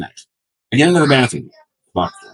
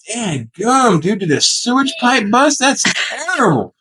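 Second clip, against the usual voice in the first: higher, appalled hillbilly exclamation; disgusted stranger; 10 semitones higher, slower than usual. Retold or imitated. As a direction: react like you smelled a sewer. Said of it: "I hear someone from the outside going".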